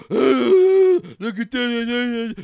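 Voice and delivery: loud, slow mock heckling of the co-host. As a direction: shout it out like a pesky heckler. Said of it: "not be like".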